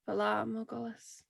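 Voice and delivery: soft, hushed murmur of a greeting; whispered. Acted. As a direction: keep it hushed and reverent, as to a raven. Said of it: "and whisper".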